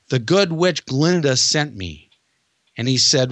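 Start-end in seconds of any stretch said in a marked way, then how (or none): 0.1-2.1 slow, a hint of a sweet girl; lightly voiced Dorothy. Dorothy tells the guard Glinda sent her, slow and lightly sweet. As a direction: play the referral card slowly, little-girl innocent.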